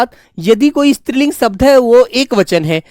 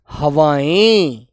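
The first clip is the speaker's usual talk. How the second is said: very slow and lower, vowels stretched; drawn-out model answer; 5 semitones lower, much slower than usual. Shown as a demonstration. Teacher answering own question for the class, drawn out slowly.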